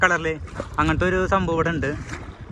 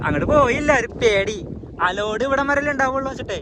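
higher, louder, nervous excitement; excited; 9 semitones higher, 4 dB louder than usual. Real, not acted. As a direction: blurt it out, a bit rattled. Boat rocking, admits being scared. (ആവേശത്തോടെ, ഉയർന്ന ശബ്ദത്തിൽ)

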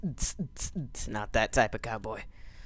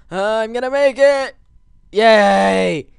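high loud triumphant cheer; excited cheer; 9 semitones higher, 11 dB louder, slower than usual. Real, not acted. Gamer cheering as he finally lands it. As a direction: whoop with joy.

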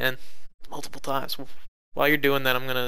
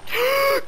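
sharp startled gasp. Real, not acted. Grenade lands at his feet.

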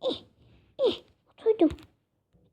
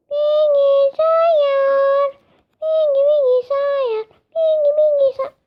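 "Bilgisayar" stretched very slowly, higher; drawn-out word; 6 semitones higher, slower than usual. Shown as a demonstration. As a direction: savour "Bilgisayar" like a magic word. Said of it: "repeated in drawn-out high voice". ("Bilgisayar" çok yavaş ve yüksek sesle uzatılarak)

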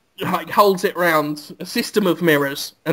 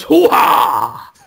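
shouting hoo-ha, rough and higher; Al Pacino impression; 6 semitones higher, 3 dB louder than usual. Teller attempts Al Pacino's hoo-ha, hammed up. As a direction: bark it like a bad Pacino. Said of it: "I can't do him at all".

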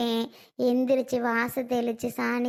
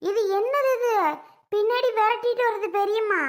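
alarmed complaint; 7 semitones higher than usual. Acted. Chased girl cries out to her aunt in alarm. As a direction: cry out that she is being chased.

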